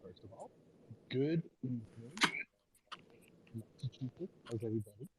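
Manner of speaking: very faint, cutting out; faint voice over a bad line; barely audible. Real, not acted. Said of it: "You're very low. I can barely hear you".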